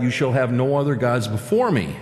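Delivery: normal talking voice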